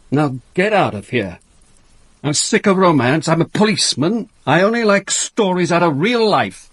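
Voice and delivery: loud, rising fed-up shout; angry outburst. Acted. Inspector loses patience, orders her out.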